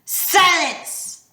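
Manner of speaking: high, sharp angry snarl. A narrator's reading. Medusa cutting off Annabeth, snarling. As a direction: snap it like a furious monster. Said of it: "Medusa snarled".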